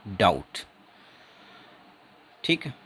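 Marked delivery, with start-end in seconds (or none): 0.1-0.6 डाउट with no b, falling at the end; silent b. Shown as a demonstration. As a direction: say डाउट straight from the vowel to t. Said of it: "इसमें बी silent रहेगा". (डाउट में बी silent)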